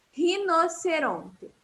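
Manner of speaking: Rinoceronte said slowly with a throaty h-like R at the start; slow careful Rinoceronte; model word slowly. Shown as a demonstration. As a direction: Rinoceronte drawn out syllable by syllable for repeating.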